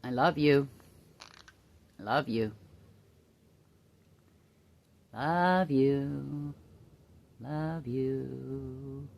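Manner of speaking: soft, tender love you to the bird. Real, not acted. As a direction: whisper it tenderly to your pet.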